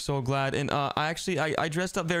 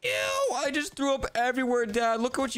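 much higher squeal of disgust; 9 semitones higher than usual. Acted. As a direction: recoil at the mess. Son just threw up.

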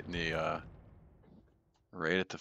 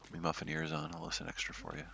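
hushed aside; 7 dB quieter than usual. Real, not acted. Jokingly putting on headphones.

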